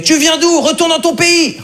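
high hammed-up hostile shout. Online commenter telling the immigrant to go home, mock angry yell. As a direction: bark it like an online troll. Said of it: "un mec qui a commenté".

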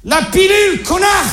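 réponse excédée, lancée très haut dans l'aigu; assène la chute en père furieux; colère jouée. Acted. Il assène la réponse à ses ados. (colère de comédie, voix suraiguë)

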